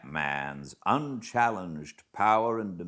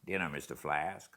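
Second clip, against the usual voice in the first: quick, clipped announcement of dinner; rapid call; 3 semitones lower, 7 dB quieter, faster than usual. Acted. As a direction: rattle it off fast and softer. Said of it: "with a rapid".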